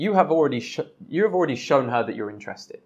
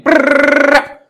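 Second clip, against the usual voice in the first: high, loud garbled brrr, like speech too fast to follow; garbled rushed noise; 14 semitones higher, 13 dB louder than usual. Retold or imitated. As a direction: spit out a burst of gibberish. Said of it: "just started shouting at you".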